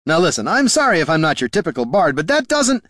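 high protest climbing to a break-off; indignant protest. A narrator's reading. Bard defending himself to companions, indignant protest.

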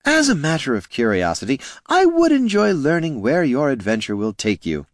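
courteous words with a hidden sneer; smooth gnome Flynn. A narrator's reading. Flynn needling the heroes, mock politeness.

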